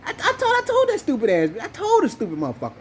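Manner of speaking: high, strained I-told-you; exasperated outrage. Real, not acted. Complaining to friends about the mother, high and exasperated. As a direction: throw up your hands, told her so.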